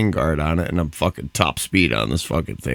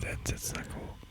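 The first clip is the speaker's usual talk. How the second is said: hushed worried whisper; frantic whisper; 18 dB quieter than usual. Real, not acted. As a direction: whisper it urgently. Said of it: "Why are we whispering?".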